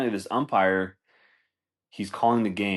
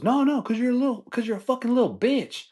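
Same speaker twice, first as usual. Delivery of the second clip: much higher, sneering put-on voice; mocking umpire insult. Umpire insulting the coach to his face, high and sneering. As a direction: sneer the insult in a squeaky mock voice.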